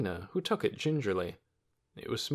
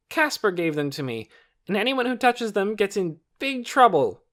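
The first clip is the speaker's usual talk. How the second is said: put-on threatening boy; 11 semitones higher, 7 dB louder than usual. A narrator's reading. Torin threatening about his bag.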